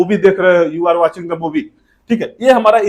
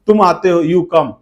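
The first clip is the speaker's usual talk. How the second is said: ordinary delivery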